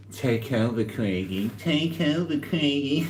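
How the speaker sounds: high teasing call of his name; a mock taunt with a rising tune on Craigie; mocking sing-song. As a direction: taunt him playfully, high and lilting.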